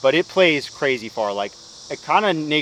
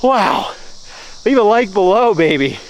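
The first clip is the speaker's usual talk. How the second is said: loud, high, awestruck elation; freaking out; 8 semitones higher, 7 dB louder than usual. Real, not acted. Player celebrating a perfect line with viewers, loudly elated. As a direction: savor it loudly in short, elated bursts. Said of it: "I'm literally freaking out right now".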